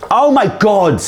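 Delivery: sudden high cry of frustration; exasperated outburst. Real, not acted. Host flubbing his line again, exasperated cry. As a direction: blurt it out, fed up with yourself. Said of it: "frustrated at flubbed take".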